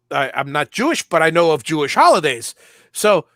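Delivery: normal talking voice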